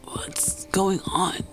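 weak, slow whisper; choked whisper. Acted. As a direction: barely get the words out. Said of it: "she managed to choke out".

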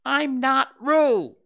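high, slow, loud Piglet voice; loud protest. A narrator's reading. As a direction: hammer out each word indignantly. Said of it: "said Piglet loudly".

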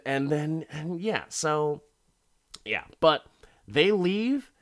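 ordinary talk, nothing marked

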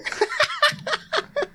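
breathless high-pitched laughter; wheezing laugh; high squeaky laugh. Real, not acted. Laughing fit.

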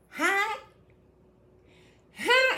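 high-pitched little hi; mock sweet greeting. Trouble knocking at your door, high mock greeting. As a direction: pitch it way up like an unwelcome caller.

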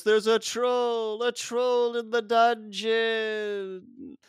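high, drawn-out mock alarm; Quirrell's panicked cry. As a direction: imitate a famous panicked announcement, stretching every word. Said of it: "we get the very famous".

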